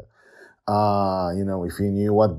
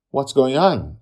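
high, loud bewildered demand; 8 semitones higher, 9 dB louder than usual. A narrator's reading. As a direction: raise your voice for an explanation. Sister demands an explanation.

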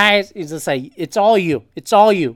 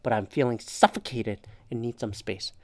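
soft, much quieter and lower reading; gentle therapist phrasing; 6 semitones lower, 10 dB quieter than usual. Retold or imitated. Reading the expert's suggested line in a soft, quiet voice. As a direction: read it like a calm counselor. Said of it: "what to say instead?".

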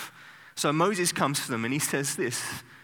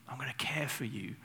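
gentle whisper; 8 dB quieter than usual. Acted. God's caring words to Israel.

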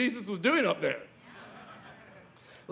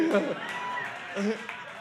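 breathy laugh; 4 semitones lower, 6 dB quieter than usual. Real, not acted. Laughs after his own line.

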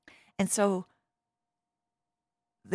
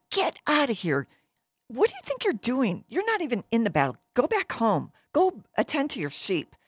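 higher-pitched acted telling-off; scolding brothers; 8 semitones higher, 3 dB louder than usual. Older brothers sending David home from battle, mildly hammed-up scolding. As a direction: shoo away a pesky little brother.

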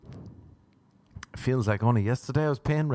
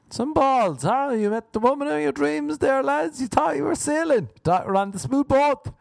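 rally the lads, hammed up; mock rousing; 12 semitones higher than usual.

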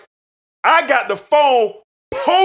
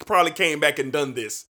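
normal talking voice

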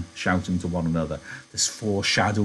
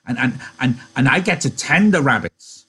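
higher, louder, stammering delight; excited Lennie; 6 semitones higher, 6 dB louder than usual. A narrator's reading. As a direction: trip over your words with joy. Lenny excitedly continues the dream.

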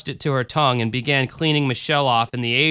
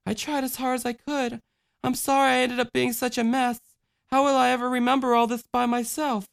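put-on high, quiet childlike voice; tired girl apologising, softly; soft little girl; 10 semitones higher, 4 dB quieter than usual. A narrator's reading. Michelle speaks.

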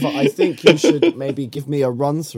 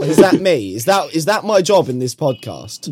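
usual speaking voice

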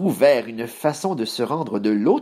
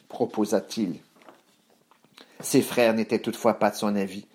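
usual speaking voice

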